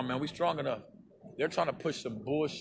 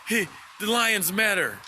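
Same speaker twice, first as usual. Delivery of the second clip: sarcastic mock slogan; 6 semitones higher, slower than usual. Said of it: "we're gonna say".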